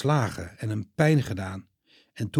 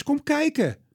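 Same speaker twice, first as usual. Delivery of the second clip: higher calling John's name. Acted. Peter calls John into the tomb, higher call. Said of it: "roept Petrus".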